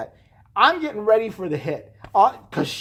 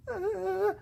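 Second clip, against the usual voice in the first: tiny, high, soft whimper; frightened whimper; 12 semitones higher, 9 dB quieter than usual. Retold or imitated. Frightened girl asked to identify someone, high nervous whimper. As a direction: squeak out a wordless non-answer. Scared girl cannot answer.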